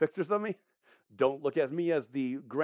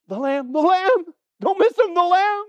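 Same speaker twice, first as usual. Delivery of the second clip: high, loud excited cry; urgent calling out; 14 semitones higher, 12 dB louder than usual. Acted. John pointing at the man on the hillside, urgently calling. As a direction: shout so nobody misses him.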